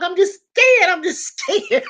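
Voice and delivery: very high, cracking laugh-cry; tearful laughing fear. Retold or imitated. Scared bride telling her brother she's only scared, laugh-crying high. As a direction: squeak it out, half laughing, half crying.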